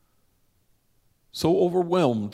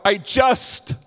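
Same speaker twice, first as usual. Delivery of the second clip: blurting out; 7 semitones higher, 6 dB louder than usual. Retold or imitated. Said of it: "he blurts out".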